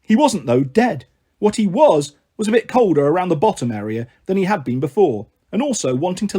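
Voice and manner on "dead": ordinary delivery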